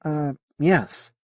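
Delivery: half-listening, mind elsewhere; absent-minded. Acted. Sally answering the alien while distracted. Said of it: "Sally answered absently".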